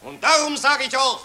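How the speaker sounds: pompous orator addressing the crowd; patriotic street speech. Acted. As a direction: harangue the citizens with fake grandeur. (pathetische Straßenrede)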